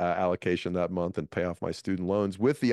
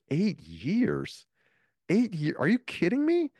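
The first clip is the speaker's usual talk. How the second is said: rising incredulous yelps, each one higher; retold disbelief; 12 semitones higher, slower than usual. Told the comic needs eight years, shocked disbelief. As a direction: pitch climbing higher and higher in outrage. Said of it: "I was like".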